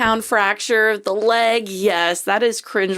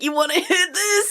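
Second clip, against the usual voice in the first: mock stoner offer; 10 semitones higher than usual. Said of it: "and he's like".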